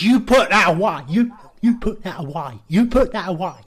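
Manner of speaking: loud scolding shout; shouting put that away; yelling at him. Retold or imitated. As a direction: tell him off through the glass.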